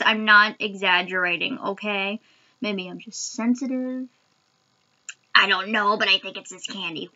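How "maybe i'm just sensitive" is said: ordinary talk, nothing marked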